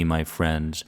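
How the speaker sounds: normal talking voice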